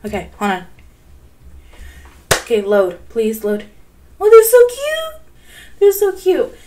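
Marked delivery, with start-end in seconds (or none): 4.2-6.6 high, loud gushing squeal; squealing over cute animals. Real, not acted. Gamer squealing at the trailer's baby animals, high and gushing. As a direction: coo like you just saw a puppy.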